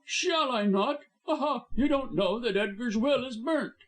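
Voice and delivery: high gloating crow; gloating high villain voice; triumphant villain. A narrator's reading. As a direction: crow over them. Blackmailer triumphant.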